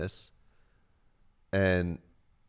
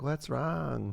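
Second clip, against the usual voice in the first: imitated girl; 6 semitones higher, 3 dB quieter than usual. Voices ghosted girl asking.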